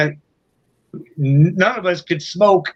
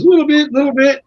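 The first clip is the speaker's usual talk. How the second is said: taxi driver's reply; 10 semitones higher, 4 dB louder, faster than usual. Retold or imitated. Taxi driver answering about English.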